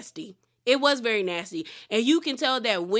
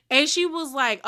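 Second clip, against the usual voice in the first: normal talking voice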